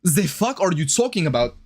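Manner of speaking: put-on comic German accent with buzzing Zs; mock German accent. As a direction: play an indignant German, Z for TH. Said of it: "to show you that I'm German?".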